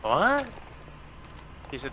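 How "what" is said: surprised. Real, not acted. Surprised question.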